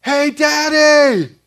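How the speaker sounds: put-on high, loud, slow child call to dad; excited shout. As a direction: call out to daddy from the zip line. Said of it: "he's like".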